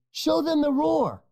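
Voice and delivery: high, excited push; eager urging. A narrator's reading. Honey badger eagerly urging his friend to roar. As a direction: egg him on to roar. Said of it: "says Bunga".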